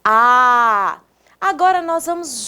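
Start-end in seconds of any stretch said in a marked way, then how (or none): 0.0-1.0 "A" held long with open mouth; long demonstrated vowel "A"; wide-open A. Shown as a demonstration. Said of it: "abrimos a boca bem grandona".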